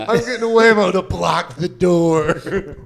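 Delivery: joking through laughter; laughing. Real, not acted. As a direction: giggle the line out. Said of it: "laughing at own joke".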